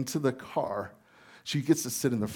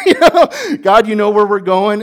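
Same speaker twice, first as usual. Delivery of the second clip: laughing; 10 semitones higher, 17 dB louder than usual. Real, not acted. Pastor laughs retelling his son's surprising request, mid-laugh. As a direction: chuckle through the words.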